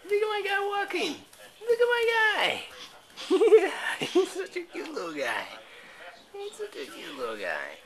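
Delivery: put-on high sing-song cooing at a toddler; baby talk. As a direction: coo at the baby, all delight. Cooing over baby son.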